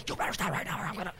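rapid, hushed parental threat; fierce whispered warning, acted; whispered yell. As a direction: scold the kids fast under your breath. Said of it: "yell through a whisper".

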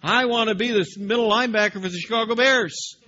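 high, loud, fast pleading whine; pleading protest. Acted. Preacher playing Jeremiah pleading with God, high and loud. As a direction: beg like a kid who wants his dream.

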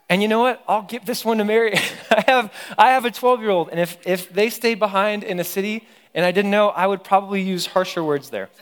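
laughing aside. Real, not acted. Preacher confessing to the congregation about her own kid, laughing. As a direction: chuckle as you own up to it.

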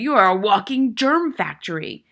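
loud, high, angry order; furious headmistress tirade; disgusted bellow. A narrator's reading. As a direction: shout the boy into the corner. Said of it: "Miss Trunchbull bellowed".

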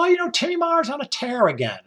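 sing-song tease in a high voice; teasing waitress. Retold or imitated. The waitress teasing Tim about his binge, in a high mock voice. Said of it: "the waitress would go".

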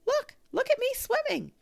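high, loud squeaky little voice; a tiny joey showing off; little Roo squeaking with pride; squeaked. A narrator's reading.

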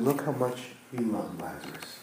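touched mourners, hushed; hushed awe. Retold or imitated. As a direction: breathe it out softly. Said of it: "around said".

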